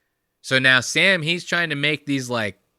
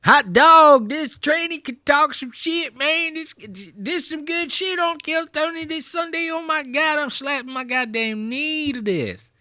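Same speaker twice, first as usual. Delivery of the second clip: hammed-up Texas crowd voice; mock Texas accent. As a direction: whoop it up like a delighted Texan. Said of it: "You know how they talk down there in Texas".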